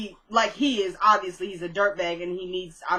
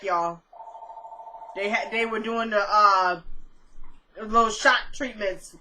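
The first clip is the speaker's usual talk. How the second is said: ordinary talk, nothing marked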